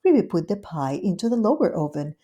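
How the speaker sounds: ordinary delivery, nothing marked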